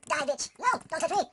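high, loud yelps at the enemy; panicked battle yell. Real, not acted. Yelling at game boss fight.

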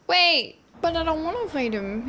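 normal talking voice

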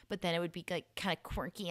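ordinary talk, nothing marked

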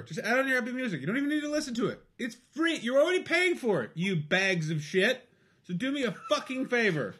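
high, exasperated sweary pleading; mock-angry rant. Guest begging listeners to add his album, mock-angry rant. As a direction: berate the listeners, voice pitched right up.

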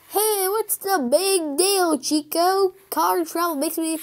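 high, stretched-out retort; defensive character voice. Acted. Piranha's excuse, acted in story.

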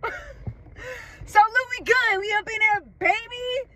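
mock Lou voice. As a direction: ham up your friend bragging he's already there. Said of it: "And he goes".

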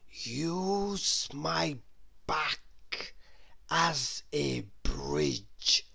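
slow, soft sly crocodile voice. A narrator's reading. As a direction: lure them in slowly and softly. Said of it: "suggested the crocodile".

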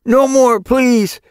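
high, loud playful begging; mock pleading. A narrator's reading. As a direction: beg playfully, hammed-up. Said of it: "pretending to be beaten".

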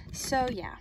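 ordinary talk

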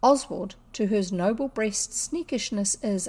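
plain reading voice

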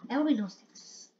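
"Everybody knows Texas" said quickly and softly, with EVERYBODY still stressed; fast, quiet muttered grumble; muttered repeat. Real, not acted. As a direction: grumble it under your breath. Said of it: "Like, why?".

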